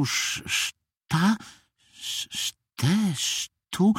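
halting false starts on a hard word; groping for a word. A narrator's reading. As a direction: stammer through syllables, hunting for the word. Bear struggles to recall a word.

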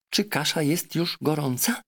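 a clever parrot lecturing her master. Acted. Parrot Polynesia urging the doctor, high and insistent.

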